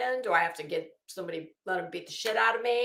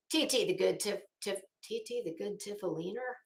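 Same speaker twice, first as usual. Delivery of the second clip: ordinary talk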